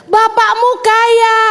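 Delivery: ordinary talk, nothing marked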